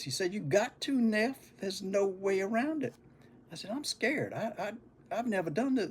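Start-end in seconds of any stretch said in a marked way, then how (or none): 0.3-2.9 a higher, insistent big-brother voice; reassuring older brother. Retold or imitated. Brother reassures scared child. 3.7-5.9 put-on higher, softer, stammering kid voice; little boy admitting his fear; scared child.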